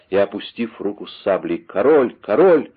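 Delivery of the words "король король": high, loud repeated cry; shouted. A narrator's reading. English soldiers think they spot the king, shouted. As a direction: shout it twice, climbing. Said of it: "закричали несколько англичан".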